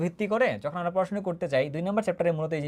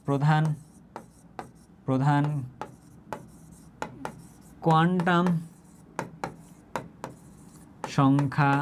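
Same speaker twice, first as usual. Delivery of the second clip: one word at a time, long pauses between; slow dictation; 2 semitones lower, much slower than usual. Shown as a demonstration. Teacher dictates the meaning of n, word by word. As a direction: space out each word for someone writing it down. Dictating a term while writing.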